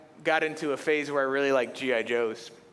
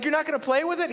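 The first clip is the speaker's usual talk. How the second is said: incredulous; 11 semitones higher, 3 dB louder, much faster than usual. Retold or imitated. Boy incredulous at friend.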